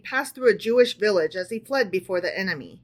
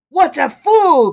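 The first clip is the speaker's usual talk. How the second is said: slow, loud scornful cry, pitched up; disdainful cry; 6 semitones higher, 11 dB louder, slower than usual. A narrator's reading. Napoleon scorning the tailor, loud disdainful cry. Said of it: "cried Napoleon disdainfully".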